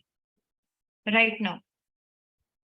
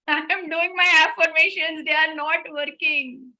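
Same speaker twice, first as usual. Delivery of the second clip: louder, exasperated whine; mock frustrated complaint; 4 semitones higher, 6 dB louder than usual. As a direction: complain your affirmations fail, fed up. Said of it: "you go even more angry".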